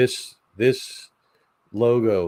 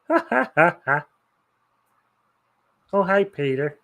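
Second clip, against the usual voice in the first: cartoon impression; 8 semitones higher, much slower than usual. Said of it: "my impression of him".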